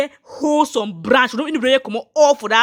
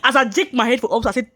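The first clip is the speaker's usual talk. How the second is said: ordinary talk, nothing marked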